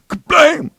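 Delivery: high, loud slam noise; door-slam exclamation. A narrator's reading. Jim tells of wind slamming the door, sudden bang.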